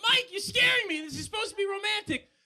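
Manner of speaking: high, loud mock-frightened girlfriend; scared girlfriend. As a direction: squeal like a spooked girlfriend. Girlfriend voiced in story.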